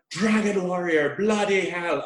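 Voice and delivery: Dragon warrior in a lightly put-on Irish-tinged accent; a jokey Irish-American movie voice; mock Irish-American. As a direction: toss it off with a hint of Irish brogue. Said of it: "American via Ireland".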